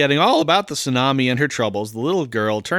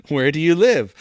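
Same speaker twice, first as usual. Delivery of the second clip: put-on raised-pitch Southern gentleman accent; 4 semitones higher, 5 dB louder than usual. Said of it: "Kind of a southern gentleman".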